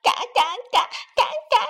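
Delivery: high, giggly duckling quacks; laughing quacks. A narrator's reading. Unafraid ducklings enjoy the splash, laughing. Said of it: "还笑着呢".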